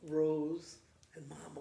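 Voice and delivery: ordinary delivery, nothing marked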